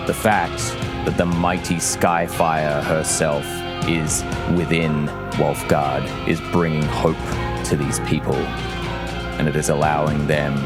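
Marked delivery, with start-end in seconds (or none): none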